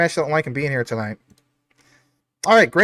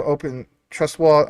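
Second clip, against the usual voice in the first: ordinary delivery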